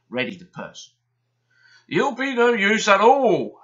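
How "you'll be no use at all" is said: loud, high huffing scorn; huffy scorn. Acted. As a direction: scoff at the smaller engine. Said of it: "huffed Gordon".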